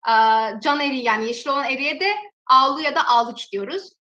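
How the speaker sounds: usual speaking voice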